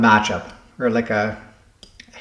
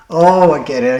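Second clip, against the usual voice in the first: sudden realization; 3 semitones higher, 6 dB louder than usual. Real, not acted. Sudden realization solving puzzle.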